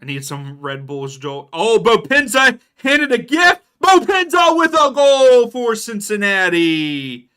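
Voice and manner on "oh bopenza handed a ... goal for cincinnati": shouted, much higher and louder, drawn out; goal call. Real, not acted. Streamer calling the Cincinnati goal, shouting. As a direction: belt out the goal like a commentator.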